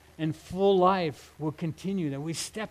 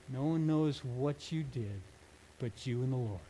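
quiet, sincere; 4 semitones lower, 6 dB quieter than usual. Real, not acted. Tender reflection on secret goodness.

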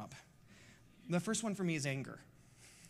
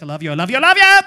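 rising into a huge, high, loud yell; dad yelling his love at his son outside school; shouted I love you; 6 semitones higher, 23 dB louder, much faster than usual. Retold or imitated. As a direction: embarrass your teen son by yelling it across the school lot. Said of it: "I'll be like".

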